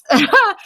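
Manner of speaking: a brief giggle while showing the cartoon; presenter giggles at the cartoon she put up; short high laugh. Real, not acted.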